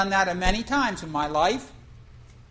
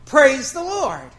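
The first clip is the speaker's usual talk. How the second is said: praise shout; 3 semitones higher, 7 dB louder, slower than usual. Retold or imitated. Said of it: "We're going".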